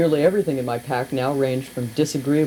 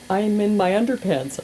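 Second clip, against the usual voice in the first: high, plaintive little wail; a mock-pitiful whine; pathetic; 8 semitones higher than usual. Narrator stuck in underpants, pleads pitifully in a high voice. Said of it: "I said pathetically".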